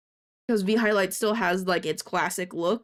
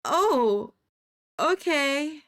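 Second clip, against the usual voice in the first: unimpressed; 7 semitones higher, 5 dB louder, much slower than usual. Retold or imitated. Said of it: "my Cool Story, Bro is".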